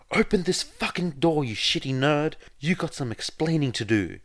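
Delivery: high, loud furious yell at the door; angry yell. Acted. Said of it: "He heard Bakugo yell aloud".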